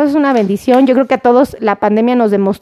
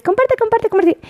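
excited fan imitation; 8 semitones higher, 3 dB quieter than usual. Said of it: "ya me dices".

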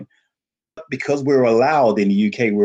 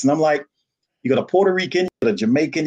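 usual speaking voice

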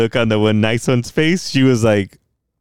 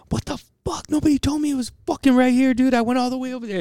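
very high-pitched mock tirade; hammed-up phone rant in a squeaky register; exasperated complaint; faster than usual. Acted angry call to colleague.

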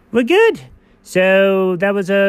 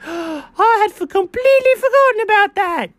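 high, near-crying gasp; mock distress; 12 semitones higher than usual. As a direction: act shocked and tearful. Gasp, remembering trauma.

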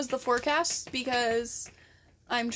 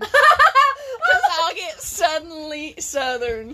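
slow, louder words through laughter; laughing; 3 semitones higher, 8 dB louder, much slower than usual. Real, not acted. Explaining her joke to her friend, laughing. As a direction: laugh while saying it.

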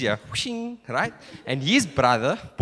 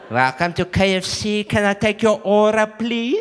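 put-on Cape Flats accent, rising to the end; Cape Flats server; 4 semitones higher than usual. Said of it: "when they go to K F C on the Cape Flats".

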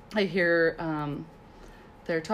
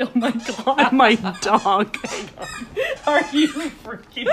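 laughing; 5 semitones higher, 6 dB louder, slower than usual. Real, not acted. Dog interrupts, laughing.

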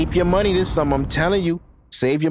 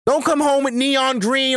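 exasperated rant, pitched higher; heated rant; 7 semitones higher than usual. Real, not acted. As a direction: demand it with rising irritation. Heated demand at women.

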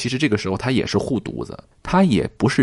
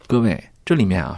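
ordinary talk, nothing marked

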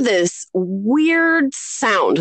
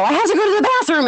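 higher, very fast blurt; hurried child; 10 semitones higher, much faster than usual. Retold or imitated. Five-year-old dashing past to the bathroom, hurried. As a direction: blurt it out as a kid rushing by.

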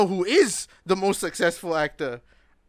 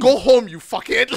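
mock angry shout, higher and louder; mean, blunt dismissal; 4 semitones higher, 7 dB louder than usual. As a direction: imitate a harsh boss sending someone away, loudly. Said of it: "Yeah, he was mean".

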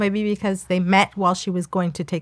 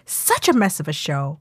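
raised, animated reading with high jumps; sassy comment reading; 6 semitones higher than usual. Acted. Co-host acting out a viewer's harsh review, sassy. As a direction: perform the review with sass. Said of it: "Then it was just like".

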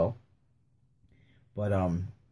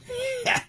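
high squeal laugh; 4 dB quieter than usual. Real, not acted. Laughs at the scheme.

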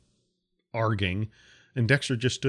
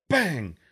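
sharp shouted bang like an impact; loud bang; 7 semitones higher, 4 dB louder than usual. A narrator's reading. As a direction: punch it out like a hit on the hull. Rock hits the ship.

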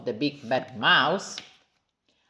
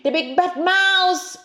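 high frightened yell; slow, high yelling cry; yelling child; 14 semitones higher, 4 dB louder than usual. Acted. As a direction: shout the name in fright. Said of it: "yelled the Gruffalo's child".

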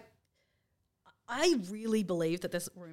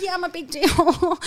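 loud, slow, higher-pitched bragging; mock boasting; 8 semitones higher, 12 dB louder than usual. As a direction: brag it out hugely, as a joke.

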